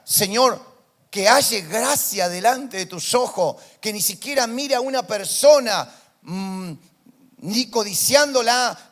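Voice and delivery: loud, pleading prayer voice; fervent prayer. Acted. Preacher voicing Moses praying to God, loud fervent plea. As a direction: cry out to the Lord.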